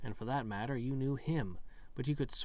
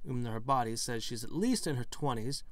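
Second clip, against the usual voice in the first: usual speaking voice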